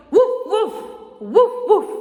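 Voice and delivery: high, loud mock woofs; dog barking. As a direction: bark like an excited dog.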